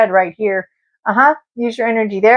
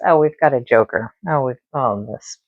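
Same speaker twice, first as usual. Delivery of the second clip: dry sarcasm; 8 semitones lower, 3 dB quieter than usual. Real, not acted. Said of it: "you're hilarious".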